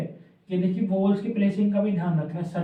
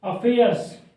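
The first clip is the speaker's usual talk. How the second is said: Dictation वाली आवाज़; 3 semitones higher, 5 dB louder, slower than usual. Shown as a demonstration. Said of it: "ए की sound आ रही है पहले".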